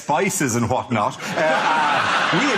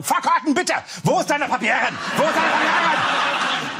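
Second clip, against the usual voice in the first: barked, much higher mock German accent; hammed-up German accent demanding papers; mock German officer; 10 semitones higher than usual. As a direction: play a stern German ticket officer, hammed up. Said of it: "ticket officer ... going".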